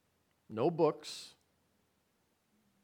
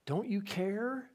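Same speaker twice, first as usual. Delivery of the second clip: mock plea; 8 semitones higher, slower than usual. Retelling disciples' plea.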